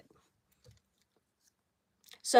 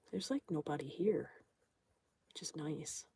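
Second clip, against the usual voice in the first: breathy whispered aside, almost no voice; hushed whisper in a deserted spot; 8 semitones lower, 14 dB quieter than usual. Real, not acted. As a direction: drop to a whisper as if not to disturb the empty room. Deserted place, quiet aside.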